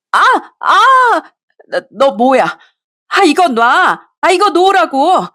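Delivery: high shrieks of pain, slowing to a drawn-out plea; pained screams. Acted. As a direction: yelp as your hair is yanked, begging to be let go. Mistress hair pulled, screaming.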